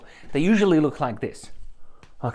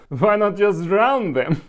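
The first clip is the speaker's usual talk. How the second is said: high, loud, slow mock-Slavic accent; her Polish accent; 6 semitones higher, 5 dB louder, slower than usual. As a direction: imitate the Polish masseuse, stretched out and pitched up. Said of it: "in her accent".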